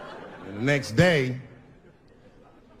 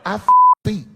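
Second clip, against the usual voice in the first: loud, higher punchline; 7 semitones higher, 10 dB louder than usual. Retold or imitated. Answering his friend, loud laughing blurt. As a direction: blurt the confession out. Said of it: "And I said".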